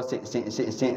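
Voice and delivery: soft, low imitated sniffles; small demonstrated sobs, kept under the breath; quiet sniffling. Shown as a demonstration. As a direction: act out gentle tearful sniffs. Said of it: "Teriak kau dah mengalir air mata".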